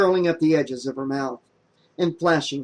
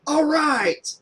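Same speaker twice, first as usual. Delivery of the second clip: cheering; 13 semitones higher than usual. Acted. Miguel cheers, slapping back.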